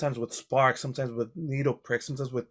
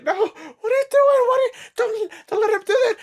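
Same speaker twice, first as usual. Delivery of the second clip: frantic, high-pitched shriek; squealing victim begging for help; panicked pleading; 7 dB louder than usual. Acted. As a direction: plead in terror, very high and loud. Game master voices the terrified victim pleading.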